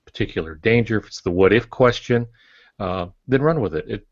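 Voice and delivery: usual speaking voice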